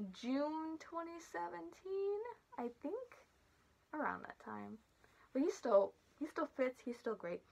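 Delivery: muffled through mascot head; talking inside costume head. Real, not acted. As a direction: voice inside enclosed mask. Seen 2: Full costume head covers the speaker's whole head while talking.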